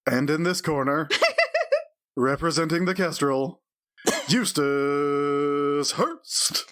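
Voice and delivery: lightly voiced ghostly announcer; drawn-out ringside announcer call; haunting voice. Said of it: "a ghostly voice echoing through the room".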